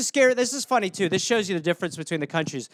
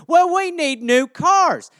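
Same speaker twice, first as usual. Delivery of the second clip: high, loud put-on disbelief; mock shocked official; 9 semitones higher, 7 dB louder, slower than usual. Official hears Saddam is still alive, high mock disbelief. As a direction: act stunned by the news. Acted dialogue.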